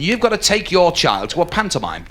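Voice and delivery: normal talking voice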